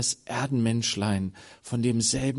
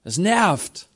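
annoyed crowd; 4 semitones higher, 5 dB louder, slower than usual. Acted. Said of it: "die Leute haben gesagt".

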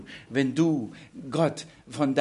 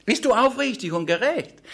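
higher and louder, needling each question; goading challenge; 7 semitones higher, 5 dB louder than usual. Acted. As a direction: press him hard, daring him. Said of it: "Darum sagte uns".

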